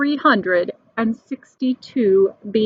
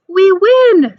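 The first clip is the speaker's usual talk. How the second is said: loud high gloating scream; 8 semitones higher, 7 dB louder than usual. A narrator's reading. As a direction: crow the win out, loud and high. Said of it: "screamed the guest".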